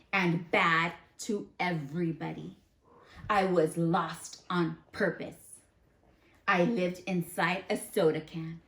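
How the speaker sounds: gentle read-aloud voice; reading to children. Acted. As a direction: read it like a picture book to the class. Reads the bad seed's story aloud.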